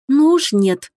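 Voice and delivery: mildly peeved; grumpy. A narrator's reading. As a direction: grumble a firm objection. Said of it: "ворчливо буркнула Элизабет сама себе под нос".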